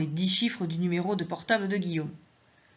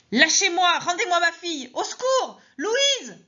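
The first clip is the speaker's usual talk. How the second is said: frantic screaming; 12 semitones higher, 4 dB louder than usual. Acted. Struggling against nurses, screaming.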